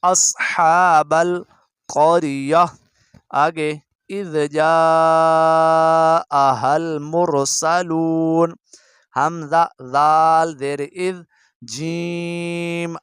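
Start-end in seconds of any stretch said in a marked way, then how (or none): 0.0-2.7 slow, drawn-out tilawat; measured Quran reading; Quran recitation. Shown as a demonstration. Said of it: "कैसे पढ़ेंगे?". 3.4-8.6 slow, drawn-out tilawat; measured Quran reading; Quran recitation. Shown as a demonstration. Reciting Surah Yasin.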